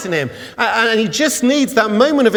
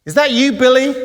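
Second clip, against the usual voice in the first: hero calling down, raised and lightly acted; higher, louder call of recognition; Aladdin; 7 semitones higher, 5 dB louder, faster than usual. Aladdin spots a boy in the crowd, calling down. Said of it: "looks down and says".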